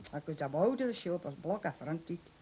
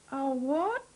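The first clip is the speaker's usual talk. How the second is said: higher, louder baffled "A what?"; puzzled repeat; 8 semitones higher, 4 dB louder than usual. Retold or imitated. Shopkeeper repeating his question to a foreign customer, higher and louder. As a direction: ask "what" again, higher and louder, in disbelief.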